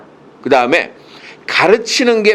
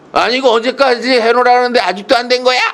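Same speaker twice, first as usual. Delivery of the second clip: higher-pitched mock scolding; principal's scolding; 7 semitones higher, faster than usual. Mimicking a principal scolding the teachers. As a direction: nag like an impatient principal. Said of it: "맨날 그런 야단만 들었잖아".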